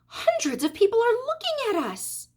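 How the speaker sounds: high, fretful acted worry; acted fright at being watched, pitched high; scared whisper. A narrator's reading. Mrs. Zuckerman frightened by hundreds watching, high and fearful. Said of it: "whispered Missus Zuckerman".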